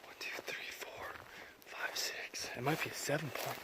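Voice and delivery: hushed counting under breath; whispering. Real, not acted.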